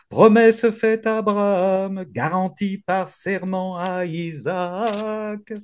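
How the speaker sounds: lifted, ringing psalm reading; high, fervent liturgical declaiming; preaching proclamation. Acted.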